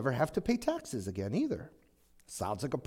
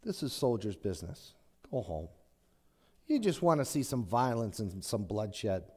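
condescending; 6 semitones lower, 5 dB quieter than usual. Retold or imitated. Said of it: "very condescendingly".

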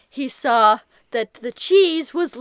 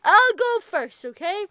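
usual speaking voice

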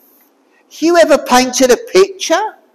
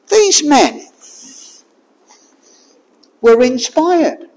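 usual speaking voice

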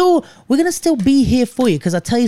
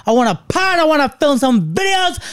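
excited; 8 semitones higher than usual. Real, not acted. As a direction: pitch rising higher each line. Building excited list.

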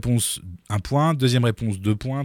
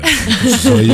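teasing stage hypnotist, raised; mock hypnotist command; 8 semitones higher, faster than usual. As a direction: snap the command out like a showman.

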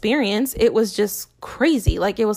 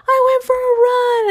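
mock breezy self; 5 dB louder than usual. Retelling coming home from a run.

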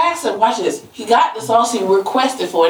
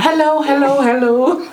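sing-song phone greeting; Motorola commercial voice; 5 semitones higher, slower than usual. Retold or imitated. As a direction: ring out hello like an old ad. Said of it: "the old Motorola commercial".

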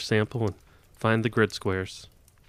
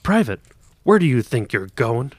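called out; 2 semitones higher, 7 dB louder than usual. Acted. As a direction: hail him from a distance. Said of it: "another voice called out".

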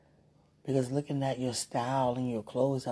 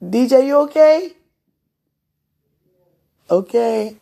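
shouting across the house, higher and much louder; calling out; 9 semitones higher, 18 dB louder than usual. Real, not acted. Mother calling to her son after dishes dropped, loud call.

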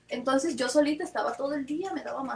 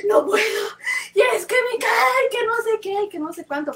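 high, loud hammed-up wail; mock crying; 11 semitones higher, 7 dB louder than usual. Daughter answering her mother about her face, mock-crying wail. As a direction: blubber it out, imitating your own crying fit. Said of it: "Deja de llorar".

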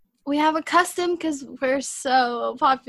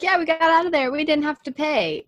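higher, louder smug boast; 5 semitones higher, 4 dB louder than usual. Retold or imitated. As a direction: brag like you got away with it. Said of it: "He was like".

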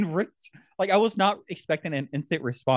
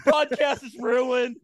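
mock outburst; 8 semitones higher than usual. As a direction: play the friend losing it, half laughing. Mocking Rick's outburst.

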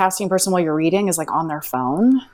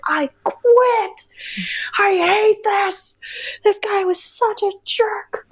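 high, slow, whiny complaint; telling mom at the coffee shop she wants to quit, whining; upset venting; much slower than usual. Retold or imitated. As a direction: whimper the complaint out like you're done. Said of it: "I was like".

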